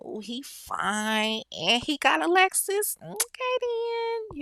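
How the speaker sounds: drawn-out, high-pitched approving squeal; smitten admiration. Acted. Smitten woman sizing up a man in a Lexus, drawn-out high approval. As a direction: stretch it slowly, climbing high with interest.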